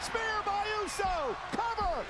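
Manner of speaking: high excited near-fall call; wrestling commentator. Real, not acted. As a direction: shout the pin count. Live play-by-play near fall.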